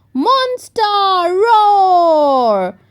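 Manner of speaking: high then loud monster shout; mock monster roar. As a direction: roar like a playful little monster. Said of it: "shouted George".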